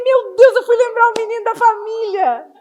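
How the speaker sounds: high, loud startled exclamation; retelling her startled outburst with the toddlers; mock dismay. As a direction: exclaim in alarm at what she set off. Her own startled reaction retold.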